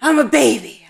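playful pet voice, loud; dog's voice. Retold or imitated. Voices the dog talking back.

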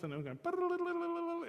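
mock chime. Imitating a dinner triangle chime.

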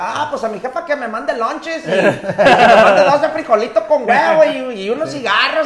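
put-on high, laughing jailhouse drunk; drunk detainee voice. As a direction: ham up a cheerful drunk prisoner. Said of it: "ese güey dice".

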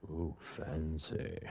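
low, slow teasing ooh; drawn-out mock-impressed ooh; mock posh. Teasing about fancy hotel.

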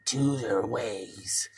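slow, unvoiced whisper. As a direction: breathe the words slowly, no voice at all. Dream voice giving a choice.

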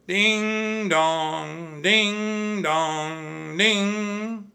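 high sing-song bell chimes; bell imitation. A narrator's reading. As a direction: ring it out like a church bell. Church bell ringing.

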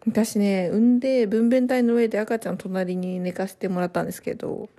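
ordinary delivery

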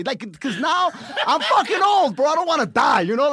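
high, loud comic shouting; panicked protest. Retold or imitated. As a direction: shout it in exaggerated alarm. Retold panic about coke and age.

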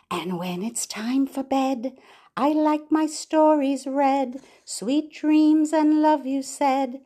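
bright sing-song rhyme for babies; nursery rhyme reading. Acted. Reading a rhyme to babies, sing-song. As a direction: read it lilting, to a little one.

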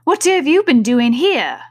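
high, loud demand; angry queen. A narrator's reading. Queen questioning gardeners at the rose tree, angrily. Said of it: "she went on".

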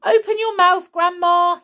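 put-on high, loud boy's command; urgent cry. A narrator's reading. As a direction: call out urgently to Grandma. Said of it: "he cried".

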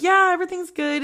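high-pitched fake all-fine voice; feigned casualness. Medium hides her secret from friend, feigned casualness. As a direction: cover up with a too-high, breezy reply.

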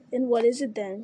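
plain reading voice

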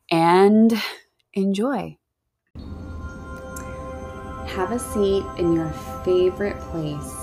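slow, soft hypnotic meditation voice; guided meditation. Acted. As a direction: ease them into calm, slowly.

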